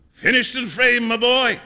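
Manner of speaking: high, loud, hearty announcement; triumphant. A narrator's reading. Painter to friend about the picture, triumphant. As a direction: boom it out proudly.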